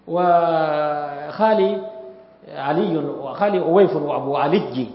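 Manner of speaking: وخالي علي said slowly, drawn out, ya turned toward jim; dialect ya to jim. Shown as a demonstration. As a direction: recite the line slowly, stressing the doubled ya as a jim. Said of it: "الياء المشددة بعض العرب يحولها إلى جيم". (إلقاء بطيء مع نطق الياء جيمًا)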